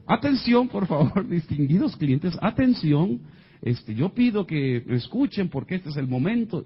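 high, projected call for attention; event announcer. Retold or imitated. Manager calling distinguished clients to attention, announcer style. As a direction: imitate a host addressing the guests.

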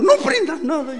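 high, loud mock-panicked order; tense novice driver. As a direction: snap at passengers in overacted tension. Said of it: "totalmente concentrada".

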